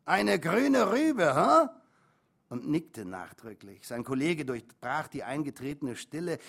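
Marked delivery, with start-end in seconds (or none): 0.0-1.7 put-on slow, high, loud drunken taunt; boozy mocking holler; drunken repeating. A narrator's reading. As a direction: crow it out, rising to a squawked question. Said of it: "wiederholte einer immer wieder".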